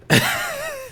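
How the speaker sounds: sudden high squeal of laughter; high burst of laughter. Real, not acted. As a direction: crack up high. Laughing at fake slang.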